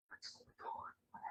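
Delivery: slow, barely audible whisper; whispered aside, drawn out; astonished whisper. Retold or imitated. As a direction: imitate onlookers whispering to each other in disbelief. Imitating trainers' astonished whispering.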